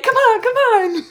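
high, laughing hurry-up; mock impatience. Rushing the buyer before midnight strikes, high laughing impatience. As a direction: egg them on, laughing.

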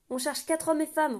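loud, high barked call; roaring. A narrator's reading. Kenan shouting up at the guard on the wall, roaring. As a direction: roar it up at the rampart. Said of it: "rugit Kenan".

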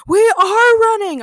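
high, loud, stretched-out yell; shouted back. A narrator's reading. Five heroes to the fleeing couple, shouting back. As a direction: shout it back slowly at full voice. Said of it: "our five heroes shouted back".

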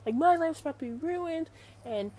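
high-pitched mock outrage; retold fury, voice pitched well up; imitated livid rant. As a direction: mimic someone going off in indignation. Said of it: "He was livid".

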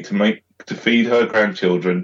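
normal talking voice

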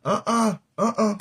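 ordinary delivery

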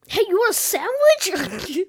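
high, loud, slow cheery sidekick. Pretend. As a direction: sound like the friendly sidekick. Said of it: "make my voice sound like the sidekick".

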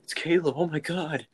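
mock awestruck squeal, as if spotting a star; starstruck players. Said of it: "they're like".

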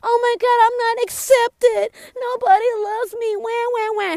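high, hammed-up crybaby voice; mocking high-pitched bellyaching; mock whining. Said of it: "I was whining too".

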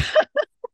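squealing laugh; high burst of laughter. Real, not acted. High laugh as the healer reads her friend's mantra. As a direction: let out a sudden high laugh.

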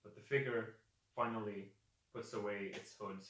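ordinary delivery

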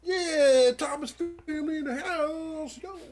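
loud, high, drawn-out shout-outs; hype man. Pretend. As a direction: hype up the crowd like an MC. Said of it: "I feel like a hype man".

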